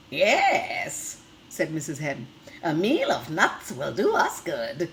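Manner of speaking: lively storybook reading for little ones; reading to children. Acted. Teacher reading a picture book to the class, lively storytelling. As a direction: read the tale brightly to the class.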